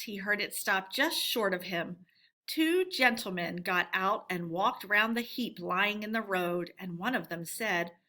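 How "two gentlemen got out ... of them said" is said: ordinary delivery, nothing marked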